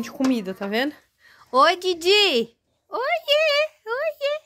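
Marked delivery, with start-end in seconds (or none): 1.5-4.4 high, slow, cooing hello; squeaky cutesy hi. Real, not acted. Greeting someone with baby talk.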